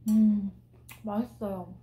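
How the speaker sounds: talking while chewing cookie; muffled speech around food; mouth full, chewing. Real, not acted. Seen 4: Bites cookie at -1, lips closed chewing throughout the words.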